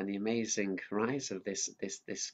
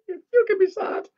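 much higher puppet echo; 12 dB louder than usual. Acted. Mickey the puppet echoing the leader, high-pitched.

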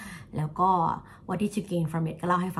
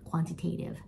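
quantitative whispered, stress on tative; whispered model word; 4 dB quieter, much slower than usual. Shown as a demonstration. Teacher softly modelling quantitative for students, whispered. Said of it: "tative นะคะ".

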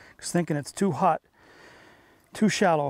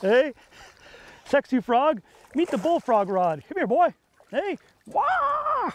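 excited calling out; 8 semitones higher, slower than usual. Real, not acted. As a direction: hype the fish up like calling a dog. Cheering a fish on the lure.

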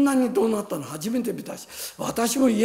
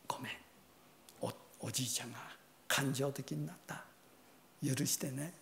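much softer, low tender plea; soft apology; 6 semitones lower, 14 dB quieter, faster than usual. Retold or imitated. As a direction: apologise gently in a hug. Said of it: "ハグして".

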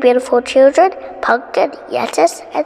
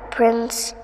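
ordinary delivery

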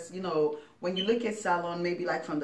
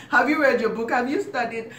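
higher, louder nagging questions; mock nagging parent; 6 semitones higher, 6 dB louder than usual. Parent quizzes kid on studying, higher louder mock nagging. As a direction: nag like a strict parent.

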